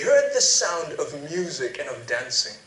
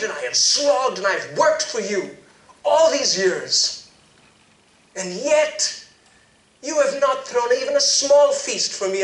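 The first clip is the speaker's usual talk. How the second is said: high, loud, bitter complaint; older son furious at his father; angry; 9 semitones higher, 8 dB louder than usual. Acted. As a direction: hurl your resentment at your father. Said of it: "he was so angry".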